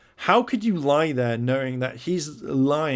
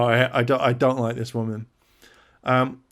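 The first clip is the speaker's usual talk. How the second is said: ordinary talk, nothing marked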